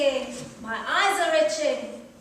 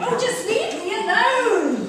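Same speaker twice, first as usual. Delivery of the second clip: exasperated outburst; 7 semitones higher, 8 dB louder than usual. Acted. Fed up with the fussing carer.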